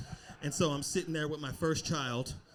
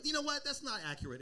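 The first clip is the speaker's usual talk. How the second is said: usual speaking voice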